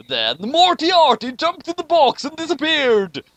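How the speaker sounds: high, loud, gleeful announcement; big excited storyteller reveal; dramatic narration. Acted. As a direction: announce the twist with gusto. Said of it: "dramatic narration of twist".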